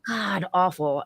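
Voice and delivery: normal talking voice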